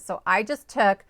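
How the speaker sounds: usual speaking voice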